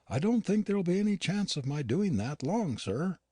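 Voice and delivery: lightly voiced housekeeper, uneasy and unwell; Yorkshire housekeeper Nancy. A narrator's reading. Nancy hints Halliday is dying.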